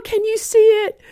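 put-on high childlike voice, eager; little boy. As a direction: play the delighted blind boy. Boy quoted again.

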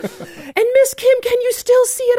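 put-on high childlike voice, eager; little boy. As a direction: play the delighted blind boy. Boy quoted again.

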